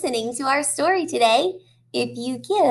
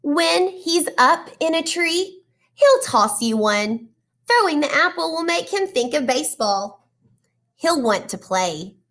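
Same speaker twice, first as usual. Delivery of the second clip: put-on reading to children; 2 semitones higher than usual. Teacher reading a picture book to her class, animated storytelling. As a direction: draw the kids into each page.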